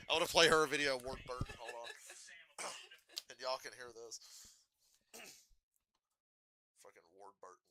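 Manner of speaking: normal talking voice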